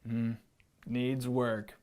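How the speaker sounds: unimpressed low lion; a low lion grading the pupil. Acted. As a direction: drop low and judge like a bored lion. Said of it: "said the lion".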